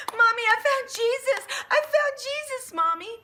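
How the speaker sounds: put-on high, loud, delighted child's voice; happy little girl. Daughter telling mommy she found Jesus, high delighted squeal. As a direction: squeal it like a thrilled small girl. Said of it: "she was so happy".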